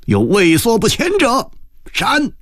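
high-pitched angry command; furious threat. A narrator's reading. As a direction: threaten the waverers, pitch soaring. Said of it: "郑春友狂怒地红着眼睛". (狂怒地高声威吓)

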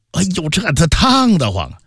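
ordinary delivery, nothing marked

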